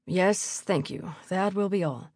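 measured, formal baron's voice; dismissive baron. A narrator's reading. Baron dismissing a visitor, formal. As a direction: dismiss them coolly as a baron.

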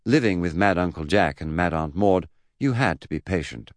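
ordinary delivery, nothing marked

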